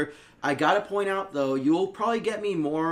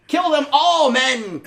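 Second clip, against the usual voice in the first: high, loud villain command; Cobra Commander voice; 10 semitones higher, 8 dB louder, much slower than usual. Retold or imitated. Villain commanding his troops, high and loud. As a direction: bark orders like a cartoon villain. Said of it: "I turned into fucking Cobra Commander".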